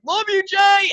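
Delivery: high, loud adoring yell; re-enacted shout to an idol; calling out. Fan repeats his parting call to the drummer, shouting. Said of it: "And I was just like".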